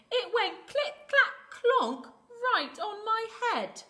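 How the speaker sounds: high, loud, agitated little bird; panicked chick. Acted. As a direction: blurt out the alarm with hard-hit sound words. Panicked chick reports the bump.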